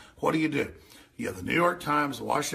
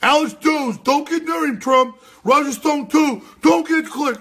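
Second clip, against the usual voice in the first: high, loud panicked mockery; mocking alarm; 11 semitones higher, 9 dB louder than usual. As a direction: ham up their warning. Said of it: "they mention".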